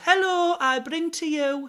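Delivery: put-on doing his old teacher's video intro catchphrase; Stephanie's catchphrase. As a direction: sweep up high like opening a video. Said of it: "she's got these really good catchphrases".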